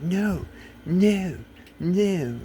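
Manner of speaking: high, rising flat refusals; imitating angry refusal. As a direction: mimic his wife shutting him down. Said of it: "she started getting really angry at me".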